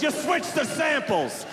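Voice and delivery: high, drawn-out shout of accusation; furious. Retold or imitated. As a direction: shout it slowly, high-pitched with outrage. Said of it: "of course he's furious".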